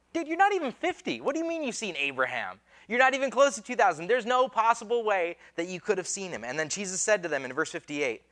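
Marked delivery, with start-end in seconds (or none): 0.1-6.4 mock disbelief, pitch leaping high at the start; incredulous objection. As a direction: scoff in disbelief like a baffled heckler.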